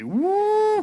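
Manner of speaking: very high, loud woo; high hammed-up cheer; celebratory whoop. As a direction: let out a long party whoop. Cheers about the smuggled edibles.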